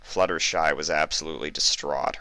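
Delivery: usual speaking voice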